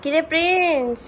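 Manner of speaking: high, loud wake-up call. Real, not acted. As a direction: shout him awake. Waking the brother up.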